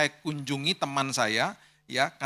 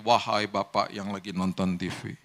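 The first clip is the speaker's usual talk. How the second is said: lower, mock-ceremonious plea to father; a lightly voiced pompous petition; stiff formal request; 6 semitones lower than usual. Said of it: "Nggak gitu ngomongnya". (permohonan resmi yang dibuat-buat, suara lebih rendah)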